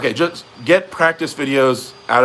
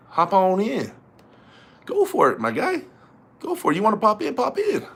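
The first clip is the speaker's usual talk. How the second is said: high, sing-song urging; playful coaxing; 8 semitones higher than usual. Real, not acted. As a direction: tease them into donating. Coaxing viewers to donate.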